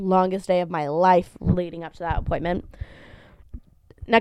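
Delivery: normal talking voice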